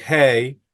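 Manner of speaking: hey with a clear ey diphthong; American-teacher pronunciation. Shown as a demonstration. Teacher quotes the name the class has used, hey with a glide. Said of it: "así es como la pronuncian en Estados Unidos".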